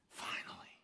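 "finally" breathed out as a soft whisper; whispered relief. Acted. Humanity sighing to God at last, whispered "finally". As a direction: let "finally" fade into a whisper. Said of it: "Humanity sighs and says".

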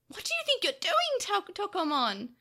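high, indignant scolding shout; angry yell. A narrator's reading. Takeru scolds Tokomon for attacking Devimon, yelling angrily. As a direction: yell in outrage at him. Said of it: "Takeru yelled angrily".

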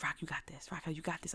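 hushed side-channel whisper; whispered encouragement. Retold or imitated. Friends privately cheering on a nervous presenter, whispering. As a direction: whisper it like a secret pep talk. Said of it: "hit me up on the side".

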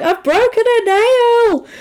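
high, drawn-out mock wail; imitating a high-pitched, slow complaint; mock outraged wail. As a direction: wail it in exaggerated dismay. Voicing woman hurt by trap.

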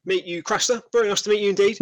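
bright welcome, pumping a hand; enthusiastic host. Acted. Host Jed greets Craster with a light hint of eager welcome. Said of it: "rather enthusiastically shakes your hand".

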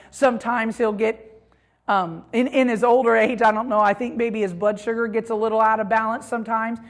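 ordinary talk

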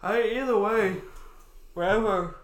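talking through a yawn; words stretched by a yawn. Real, not acted. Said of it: "damn, I can't stop yawning".

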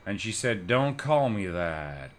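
slow, drawn-out poet's drawl; deadpan slow American poet impression; Charles Bukowski. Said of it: "I'll give you a sentence".

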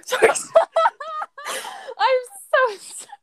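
helpless giggling through apology; can barely talk for laughing. Real, not acted. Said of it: "I'm laughing".

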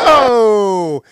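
sudden sharp high Oh; surprised exclamation. Real, not acted. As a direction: gasp out a startled Oh. Sudden slap in the match.

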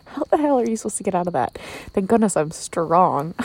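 ordinary talk

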